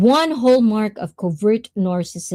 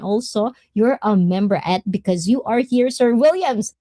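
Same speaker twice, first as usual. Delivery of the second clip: ordinary delivery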